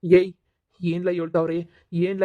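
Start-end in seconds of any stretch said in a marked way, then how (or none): 0.0-0.3 a loud sudden bark at the son; angry shout. Acted. Father rounds on his son with a loud angry call. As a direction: call him out loud and harsh.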